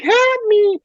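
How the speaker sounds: tiny high pleading voice; little voice. Retold or imitated. Retelling The Fly's famous ending, tiny high plea. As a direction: squeak it like a tiny trapped fly man. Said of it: "and he's like".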